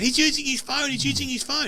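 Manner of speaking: high, accusing call-out; mock yelling out. Trivia crowd yelling that a player uses his phone, high accusing call. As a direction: pitch it up like a crowd dobbing him in. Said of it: "people are yelling out".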